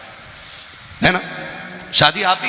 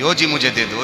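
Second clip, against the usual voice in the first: mock child begging; 5 dB quieter than usual. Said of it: "इससे ज़्यादा शोर तो हमारे यहाँ के चनों में".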